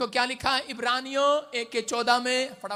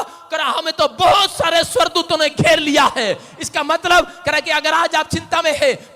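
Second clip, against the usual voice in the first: loud, high preaching voice; fiery sermon delivery, loud and high; 4 semitones higher, 12 dB louder than usual. Acted. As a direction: preach it out to the congregation. Preaching at full force.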